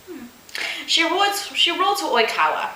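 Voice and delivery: usual speaking voice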